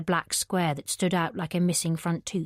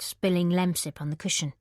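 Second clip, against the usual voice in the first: plain reading voice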